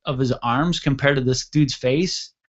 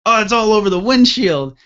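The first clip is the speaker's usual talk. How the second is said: high, loud mock scream; raised, distressed yell in a retelling; retold screaming; 7 semitones higher, 5 dB louder than usual. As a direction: imitate a screaming vehicle commander. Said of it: "the T C screaming".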